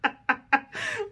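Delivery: high, rising burst of laughter; can't stop laughing; real laugh. Real, not acted. Wife on camera, helpless laughter. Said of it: "I normally laugh with reckless abandon".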